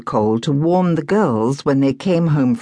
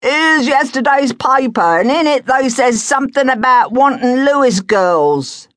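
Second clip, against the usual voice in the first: rag and bone man; 9 semitones higher, 4 dB louder than usual. Acted.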